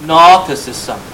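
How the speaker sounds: "Gnosticism" with the G left silent, as "nosticism". Shown as a demonstration. Teacher showing the class how to say it, "Gnosticism" with silent G. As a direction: say "Gnosticism" starting straight on the N sound. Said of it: "You don't pronounce the G".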